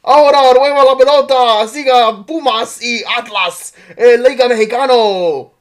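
high, loud rapid-fire Spanish play-by-play; Spanish soccer announcer. Retold or imitated. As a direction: call the match like a Mexican announcer. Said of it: "like, Spanish announcer".

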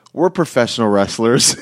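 retold excuse cracking up into laughter; laughing through it. Zach explaining himself to the security woman, laughing. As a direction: say it while barely holding back a laugh.

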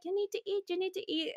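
very high, soft mock-relative voice; high-pitched insistent food pushing, mimicked; imitating pushy relatives. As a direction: nag in a high little voice. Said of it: "they're always the same".

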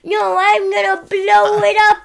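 high, loud heroic cry. Retold or imitated. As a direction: cry it out like a self-sacrificing hero. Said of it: "he was like".

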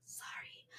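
breathy whispered apology; whispered sorry. Real, not acted.

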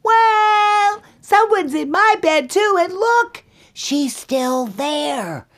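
very high, loud little bear wail; high-pitched upset bear cub; squealing Baby Bear. Acted. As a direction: squeal it out in alarm, stretching the last words. Baby Bear finds intruder in bed.

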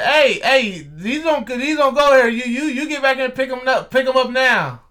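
retold bossy yelling at a courier; rude demanding shout. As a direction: imitate a loud, high-pitched order. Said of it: "that's how you normally talk to people?".

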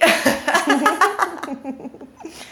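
breathy held-in giggle; stifled giggles. Real, not acted. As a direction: giggle you can't stop. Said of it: "Eu não tô conseguindo parar de rir".

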